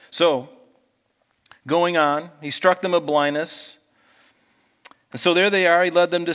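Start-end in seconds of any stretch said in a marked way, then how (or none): none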